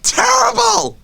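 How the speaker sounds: high, slow, crying-laughing shout; anguished laughing wail. Real, not acted. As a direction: howl in mock agony. Comic despair.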